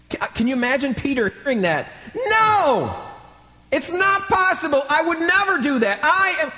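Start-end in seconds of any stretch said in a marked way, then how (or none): 2.1-6.5 loud, high-pitched protest; preacher acting an indignant disciple; vehement denial. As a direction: cry out in disbelief and refuse it outright. Acting Peter's shocked denial of prediction.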